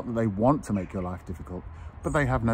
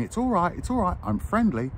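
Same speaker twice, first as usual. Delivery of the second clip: mock friendly stranger; 9 semitones higher than usual. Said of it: "And I start saying".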